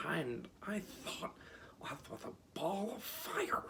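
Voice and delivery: high, slow lion exclaim. Acted. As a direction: blurt it out, rising high. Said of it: "exclaimed the lion".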